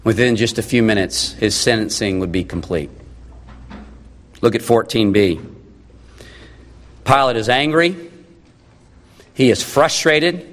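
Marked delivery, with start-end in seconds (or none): none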